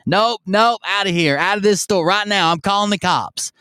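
high-pitched mock yell, no real volume; retold yelling. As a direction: imitate someone yelling to throw a customer out. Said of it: "she immediately starts yelling".

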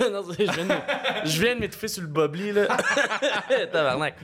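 laughing while coughing; laughing through a choking fit; rire en toussant. Real, not acted. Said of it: "Tu veux-tu tousser?". (rit en s'étouffant)

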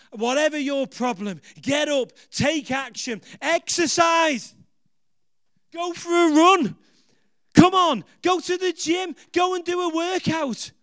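loud, high preacher's call to action; rally them to get up and move; fired-up preaching push; rousing exhortation. Acted. Exhorting the congregation to act.

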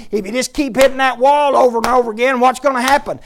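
put-on loud, high challenge to the kid; retold loud question. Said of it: "And I said".

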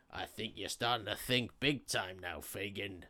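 a hint of a sinister boss; villain voice. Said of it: "And Sykes is like".

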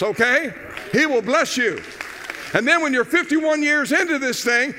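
high, loud, driving exhortation; full-force preaching. Real, not acted. Pastor urging couples into church, fired-up preaching.

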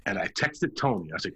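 ordinary talk, nothing marked

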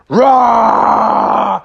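long, loud mock roar; bear roar. Bubby startles the driving zookeeper, drawn-out roar. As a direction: roar to spook someone. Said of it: "He went".

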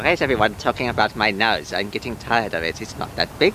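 deep grumbling voice. Acted. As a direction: grumble about your nose. Zanzibar low grumbling.

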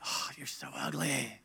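whispered nasty self-talk; mean self-talk. Pretend. Person mocking own body in a whisper. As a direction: whisper a cruel insult to yourself. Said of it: "not very nice way".

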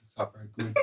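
very soft, understated greeting; eyebrow talk. Shown as a demonstration. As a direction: barely voice a casual hello. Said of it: "talk with my eyebrows".

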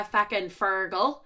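ordinary delivery

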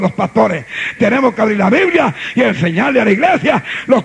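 loud, driving sermon voice; full-force preaching. Acted. Pastor preaching hard to his congregation, full-force preaching.

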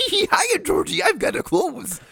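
giggly, high-pitched mocking voice; giggle, then squeak out a drawn-out greeting; Shane impression. Correcting how their absent friend said it, high giggly impression. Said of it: "Actually, it was more like".

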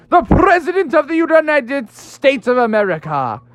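high, grandiose self-admiration; puffed-up pride. Acted. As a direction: boast grandly, swooping up in pitch. Frog admires his own reflection.

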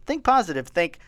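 bright, high reassuring helper; upbeat support agent. Retold or imitated. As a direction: cheer him on like a helpful agent.

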